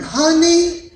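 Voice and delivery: "Honey" stretched long on a high note; drawn-out wife imitation. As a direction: draw out both syllables of "Honey". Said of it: "four seconds to say one word".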